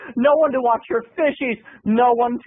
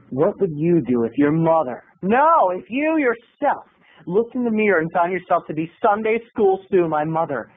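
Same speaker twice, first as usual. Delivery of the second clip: ordinary delivery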